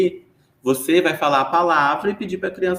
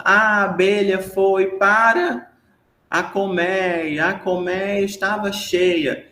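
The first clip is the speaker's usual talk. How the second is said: put-on storytelling to a child; 4 semitones higher, much slower than usual. Shown as a demonstration. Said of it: "começando a puxar uma história com a criança".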